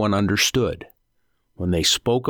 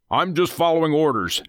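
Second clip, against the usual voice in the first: irritated comeback, pitched up; snapped; 8 semitones higher than usual. A narrator's reading. Kidnapper defending himself to the ringleader, snapping. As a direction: snap back in self-defence. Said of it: "Nathan snapped".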